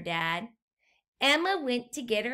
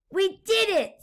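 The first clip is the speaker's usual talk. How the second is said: high, loud victory cry; triumphant; 9 semitones higher, 6 dB louder than usual. A narrator's reading. Explorer guinea pig cheers to the pigs. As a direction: celebrate the win.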